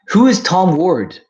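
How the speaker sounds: high, loud, drawn-out question; baffled, call out to chat; confused outburst. Real, not acted. Streamer puzzled by a chat message, high and loud.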